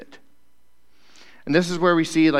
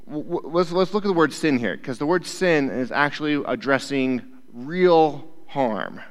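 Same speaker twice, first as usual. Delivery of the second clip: usual speaking voice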